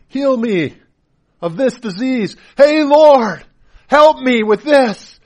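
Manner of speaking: loud, high-pitched desperate plea; screaming out. Acted. Believer begging the Lord for healing, screaming out. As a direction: cry out to God at full voice. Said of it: "pouring out to Jesus and screaming".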